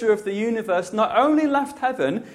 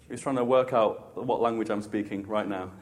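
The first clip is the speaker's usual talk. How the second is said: ordinary talk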